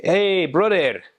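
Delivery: put-on high, swooping hail in a Spanish accent; imitated street greeting. Peruvian guys coming up to greet you, high swooping hail. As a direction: hail a pal high and drawn out. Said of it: "some guys would come up and co-, say".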